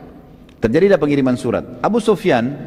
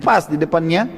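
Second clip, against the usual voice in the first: ordinary talk, nothing marked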